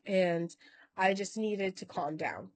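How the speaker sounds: usual speaking voice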